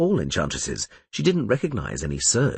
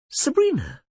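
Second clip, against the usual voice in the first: fake joy. A narrator's reading. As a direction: sound genuinely thrilled, but fake it. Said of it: "displaying such genuine joy".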